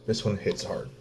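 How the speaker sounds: quiet, choked-up apology, words hurried; holding back tears. Real, not acted. Father apologizing to viewers while holding back real tears. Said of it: "I'm trying not to cry about this".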